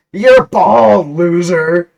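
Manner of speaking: high, loud mocking yell; taunting shout. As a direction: jeer at him at full volume. Hero taunts the Pringles guy.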